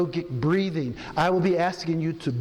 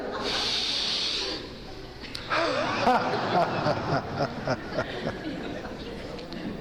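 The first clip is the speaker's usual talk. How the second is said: long group-style belly laugh; laughter exercise; 5 dB quieter than usual. Shown as a demonstration. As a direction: let the laugh roll out. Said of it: "Pretty much fake laughter right now".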